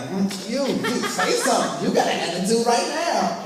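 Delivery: high, loud, laughing mock accusation; sassy attendee. Attendee glancing around at people, laughing sassy accusation. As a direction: tease the crowd with a laughing, sassy call-out. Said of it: "She's looking around at people like".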